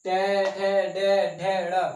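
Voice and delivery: टे, ठे, डे, ढे, ड़ called out loudly, each letter separate; loud classroom recitation of the letters; loud consonant drill. Shown as a demonstration. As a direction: hammer out the retroflex row loudly. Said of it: "इसको उच्चारण कीजिए।".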